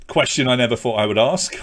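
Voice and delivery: usual speaking voice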